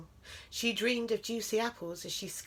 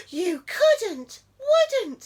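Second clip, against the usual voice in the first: very high, loud frightened squeak; squeaking mouse; 14 semitones higher, 11 dB louder, much slower than usual. A narrator's reading. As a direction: squeak it in panic. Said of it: "squeaked the mouse".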